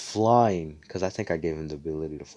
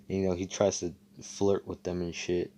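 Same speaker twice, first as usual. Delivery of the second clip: ordinary talk, nothing marked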